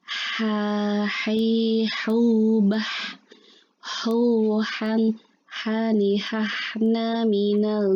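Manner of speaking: breathy "ha" from mid-throat, each syllable apart; reciting ha syllables. Shown as a demonstration. Teacher drilling the letter ha for students, breathy. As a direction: push air out on every "ha". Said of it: "Ulang ya".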